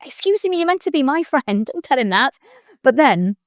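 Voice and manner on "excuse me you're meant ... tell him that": mock-outraged, pitched much higher; a playfully scandalised telling-off of friends; mock offence. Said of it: "I'm like".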